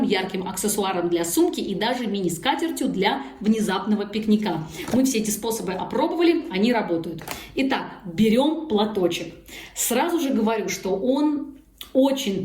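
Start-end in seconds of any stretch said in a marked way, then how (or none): none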